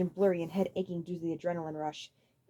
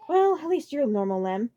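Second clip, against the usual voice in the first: Iggy voice; 5 semitones higher, 6 dB louder, slower than usual. A narrator's reading.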